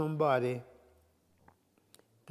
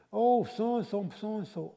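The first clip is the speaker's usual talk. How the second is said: higher, drawn-out mock exclamation; imitated social chatter; 7 semitones higher, 3 dB louder, slower than usual. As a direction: mimic their gushing over someone else, stretching the first words. Mimics people chatting past him, ignoring him.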